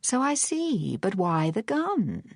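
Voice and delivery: high, languid drawl; irritating drawl. A narrator's reading. Phryne needling the gunman Charles, irritating drawl. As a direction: draw it out to needle him. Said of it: "in her most irritating drawl".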